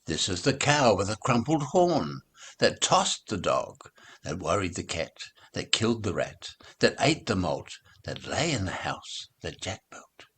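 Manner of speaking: usual speaking voice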